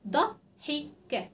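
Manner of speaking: "ضحكة" split into three short separate syllables. Shown as a demonstration. Teacher showing children short vowels, syllables separated. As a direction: break "ضحكة" into da, hi, ka with small gaps. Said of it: "تبقى حركات قصيرة".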